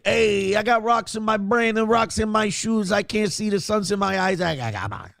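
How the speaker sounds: high, dim Italian-American guy; dumb Italian accent. Retold or imitated. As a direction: lightly play a dim Italian. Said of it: "You play dumb Italian".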